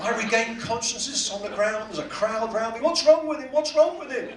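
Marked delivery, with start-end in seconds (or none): 2.8-4.3 higher, urgent mock concern; acted bystander calling out over someone collapsed; alarmed bystander. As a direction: play a worried onlooker, pitched up. Acts the crowd around him.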